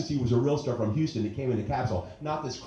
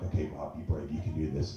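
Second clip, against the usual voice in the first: self pep talk; 7 semitones lower, 8 dB quieter than usual. Retold or imitated. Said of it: "and I'm like".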